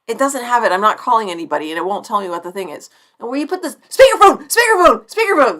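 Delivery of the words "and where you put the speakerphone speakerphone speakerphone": high, louder repeating of speakerphone; hammed-up searching for the button; playful singsong.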